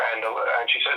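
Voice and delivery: ordinary talk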